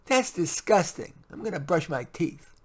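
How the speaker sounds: lightly voiced disgusted little girl. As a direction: say it like a grossed-out kid. Child Leah voiced.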